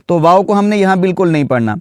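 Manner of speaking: ordinary talk, nothing marked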